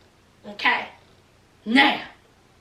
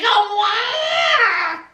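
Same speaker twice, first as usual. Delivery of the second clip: put-on baby's cry voice; 4 semitones higher than usual. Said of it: "that baby said".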